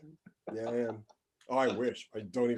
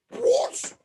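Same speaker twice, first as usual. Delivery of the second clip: high, slow, pompous British shout; posh British caricature, much higher and louder; 4 dB louder, much slower than usual. Retold or imitated. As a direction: ham up a shrill outraged aristocrat crying out. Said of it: "I can do a perfect racist British person".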